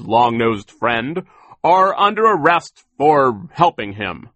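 loud, high, measured proclamation; indignant arrest. A narrator's reading. Mister Poe arrests Olaf and his friend, loud and righteous. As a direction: declare each charge with mounting outrage.

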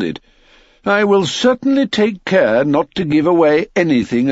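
high, aggrieved miller voice; self-pitying miller. Acted. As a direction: complain about your own loss. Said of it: "answered the miller".

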